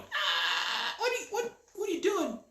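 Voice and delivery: very high-pitched panicked yelp; hammed-up freak-out, voice shooting way up. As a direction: act out a squealing panic. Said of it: "freaking out".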